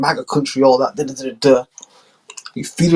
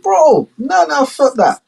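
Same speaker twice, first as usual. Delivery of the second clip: high, brash ranting; pitched-up swaggering rant; drunk mock Trump; 6 semitones higher than usual. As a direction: rant like a drunk big shot. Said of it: "He's like".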